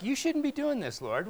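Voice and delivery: Peter's protest, voiced high and pleading; mock protest. Pastor voicing Peter objecting to Jesus, high pleading protest. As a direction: play Peter politely objecting. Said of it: "It's almost like".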